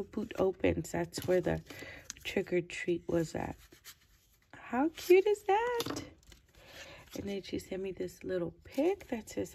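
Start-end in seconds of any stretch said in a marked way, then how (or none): 4.5-6.0 very high, loud, drawn-out gushing; real cute-overload excitement; delighted squeal. Real, not acted. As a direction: squeal it slowly, rising at the end. Delighted on seeing gift.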